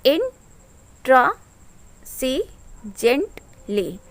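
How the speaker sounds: इंट्रासीजेंटली slowly broken into its five syllables; slow syllable-by-syllable demo of इंट्रासीजेंटली; split into syllables. Shown as a demonstration. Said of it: "इस words को थोड़ा सा divide करते हुए".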